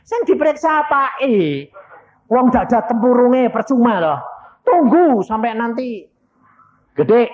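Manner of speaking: loud, high-pitched scolding; exasperated dismissal. Retold or imitated. Doctor refusing to examine the child, exasperated.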